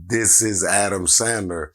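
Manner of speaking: slow, deliberate self-introduction; slow, insistent. Retold or imitated. Caller insisting on who he is, slow and emphatic. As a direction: spell it out firmly, word by word.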